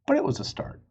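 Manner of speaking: ordinary delivery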